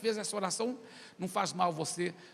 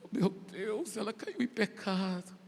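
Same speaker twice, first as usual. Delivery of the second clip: tearful retold lament; imitated crying; 4 dB quieter, slower than usual. Preacher re-enacting his grief over the fallen girl, tearful. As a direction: act out starting to cry. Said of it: "Eu comecei a chorar".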